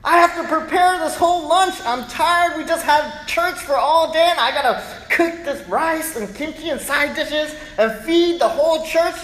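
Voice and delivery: high, loud exasperated complaint; mock furious wife; angry mom. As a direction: vent the chore at full pitch. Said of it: "my mom would be so angry".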